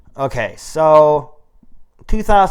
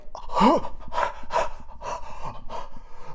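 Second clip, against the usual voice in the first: delighted gasp; 11 semitones higher, 8 dB quieter than usual. Retold or imitated. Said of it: "And I'm like".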